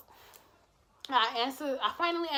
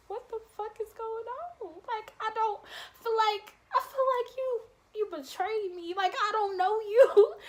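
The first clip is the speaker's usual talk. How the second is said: shocked disbelief; 10 semitones higher than usual. Retold or imitated. Shocked reaction retold.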